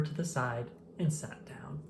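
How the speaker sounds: hushed whisper of the line; a sheepish whisper; whispered narration. A narrator's reading. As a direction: tell it softly, like a guilty secret. Sheepish narration after trouble.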